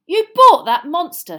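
horrified at the cat; aghast. Acted. Ron aghast at Hermione's cat, high and loud. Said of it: "his mouth hanging open".

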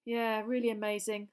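ordinary delivery, nothing marked

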